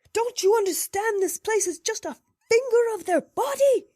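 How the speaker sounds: high, loud furious holler. A narrator's reading. Jackson cornered by his bed, hollering at the narrator. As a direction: yell it at the intruder. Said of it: "he hollered".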